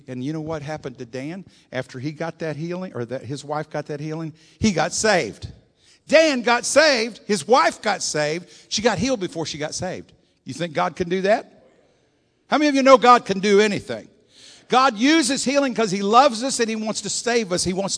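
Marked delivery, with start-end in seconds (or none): 4.6-5.5 loud, rising preacher's proclamation; proclaiming. Acted. 6.1-7.2 much higher, louder and slow, leaning hard on Dan; shouted proclamation. Acted. Preacher proclaims the conversion. 7.3-18.0 loud, high revival preaching; full-force preaching. Acted.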